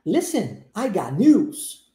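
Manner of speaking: slow, deliberate model sentence with stretched "news"; listen-and-repeat model. Shown as a demonstration. Teacher modelling for students with closed eyes, slow repeat-after-me. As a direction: say it slowly for learners to copy. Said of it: "listen and repeat".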